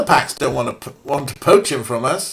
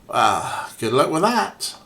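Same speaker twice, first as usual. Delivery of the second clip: ordinary delivery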